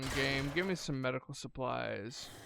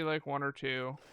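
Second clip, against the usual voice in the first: ordinary talk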